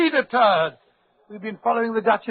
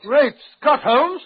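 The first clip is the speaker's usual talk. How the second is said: louder, higher burst of surprise; shocked outcry, higher and louder; astonished exclamation; 5 semitones higher, 5 dB louder, slower than usual. Acted. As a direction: gasp it out, thunderstruck. Watson sees the stolen matchbox.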